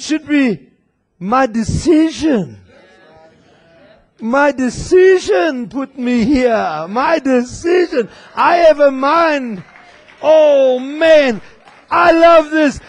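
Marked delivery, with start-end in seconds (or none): 4.2-9.6 rising shout on decision; preaching shout. Real, not acted. 10.2-12.8 louder burst of delight; thrilled outburst; can't hold in the joy; excited. Real, not acted. Preacher excited mid sermon.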